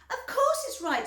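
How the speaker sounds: put-on high, loud, indignant girl; Violet. A narrator's reading. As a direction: insist she's right. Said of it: "said Violet".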